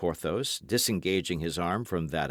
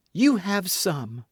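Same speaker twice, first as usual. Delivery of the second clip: eager outburst; 12 semitones higher, 4 dB louder, slower than usual. A narrator's reading. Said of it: "in a transport that surprised even herself".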